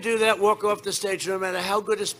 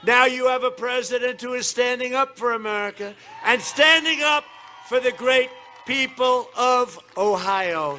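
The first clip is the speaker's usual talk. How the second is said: rally speech; 6 semitones higher, 4 dB louder than usual. Acted. Rally applause line to crowd.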